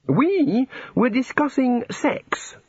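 high, slow, drawn-out relish; enthusiastic. A narrator's reading. God telling the housekeeper their topic, enthusiastic. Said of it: "said the god enthusiastically".

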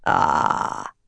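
very low, drawn-out rasp. A narrator's reading. Dark figure to the cringing woman, rasping. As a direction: rasp it out deep in the throat. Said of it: "a rasping voice said".

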